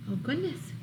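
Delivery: ordinary delivery, nothing marked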